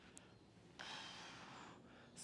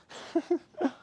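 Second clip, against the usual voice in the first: high-pitched laugh; 14 semitones higher, 6 dB louder than usual. Real, not acted. Laughs at own joke.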